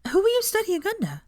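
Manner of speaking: high, bright eager student voice; a keen young pupil introducing herself; eager schoolgirl. A narrator's reading.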